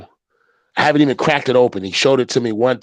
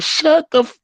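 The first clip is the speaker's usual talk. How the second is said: surprised; 3 dB louder than usual. Retold or imitated. Wife's shocked reply.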